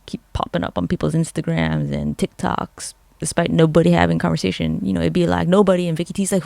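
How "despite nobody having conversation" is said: ordinary talk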